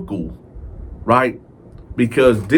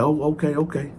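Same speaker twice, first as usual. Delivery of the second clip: meek okay; 4 semitones higher, 6 dB quieter, faster than usual. Retold or imitated. Backing down meekly.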